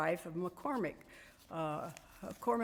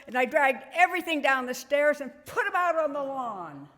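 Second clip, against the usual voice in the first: quick, mildly worked-up retelling, building louder; retold indignant rant; 4 semitones higher, 7 dB louder, faster than usual. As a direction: act out her fed-up tirade at a fast clip. Retells friend's furious revenge story.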